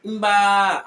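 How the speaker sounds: mba with a long, stressed vowel. Shown as a demonstration. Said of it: "you stress on the vowel".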